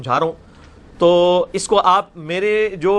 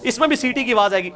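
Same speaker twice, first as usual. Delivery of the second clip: ordinary talk, nothing marked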